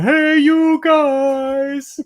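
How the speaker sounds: slow, high, dopey call to his friends; goofy lovable brute. Retold or imitated. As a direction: bellow it like the gentle giant of the gang. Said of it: "the what of the group".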